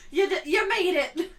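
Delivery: high, shouted cheer to mom; hammed-up, laughing celebration; mock jubilation. Daughter calling mom about her praised spelling, mock jubilation.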